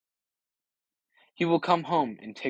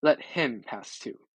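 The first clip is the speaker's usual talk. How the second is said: ordinary delivery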